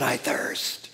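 faint whisper of thirst. Acted. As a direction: breathe it out, parched and fading. He cries out.

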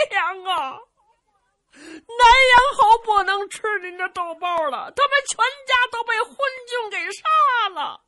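high, loud, sobbing wail; crying. Acted. Zhu Can tells his mother the marquis's family was killed, sobbing. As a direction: wail the news through tears. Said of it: "朱惨一边哭着".

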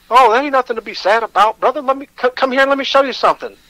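high, fast, sputtering cartoon-cat voice; Sylvester the Cat voice. Rick cheering up the sad new inmate, cartoon cat voice. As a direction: rattle it off like a splutter-mouthed cartoon cat. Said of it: "He kinda talked like Sylvester the Cat".

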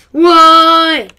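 loud, high outburst; frustrated shout. Real, not acted. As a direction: yell it in frustration. Frustrated at game.

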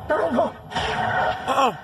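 high, loud, terrified protest; panicked refusal. Acted. Patient pleading against the woman's remedy, terrified. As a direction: fend it off in rising terror.

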